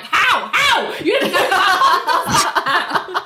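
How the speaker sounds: high, incredulous cry of "how"; exasperated disbelief. Acted. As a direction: cry out in mock disbelief, breaking into laughter. Mock outrage at the character.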